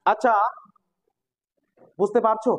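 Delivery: ordinary talk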